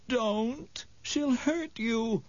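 put-on high, pleading old lady; frightened old woman. A narrator's reading. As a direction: warn him in a frail high voice. Old Mrs Griffin warns.

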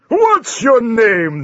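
bullying champion. Acted. The champion sneers at the thin knight, slow loud mockery. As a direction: drag out the insult with contempt. Champion demands his name.

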